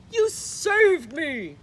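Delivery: slow, loud grateful cry; cried out. A narrator's reading. Big Bark cries out to the dogs who caught him, loud slow cry. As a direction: gasp out the thanks. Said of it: "Big Bark cried".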